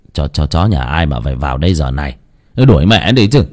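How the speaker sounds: put-on low old man wanting the dog out; deep and dismissive; gruff old husband. Ông chồng đòi đuổi chó. (giọng ông lão trầm, gắt)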